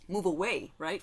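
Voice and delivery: usual speaking voice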